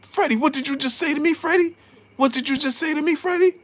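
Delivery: mock Robert Garcia. Freddie Roach walking over to Robert Garcia, high and mumbling. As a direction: fumble the words, then confront in a high voice. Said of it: "He came over there like".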